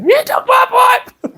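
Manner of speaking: put-on very high, slow, wailing old-lady accusation; mock old lady wail. Cranky old neighbour wailing at father about her pipe, high slow mock shriek. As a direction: stretch it into a whiny shriek. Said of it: "and then she'd".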